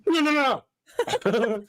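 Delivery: laughing. Retold or imitated. Quoting movie line laughing.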